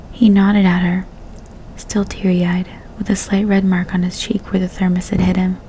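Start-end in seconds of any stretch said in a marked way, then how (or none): none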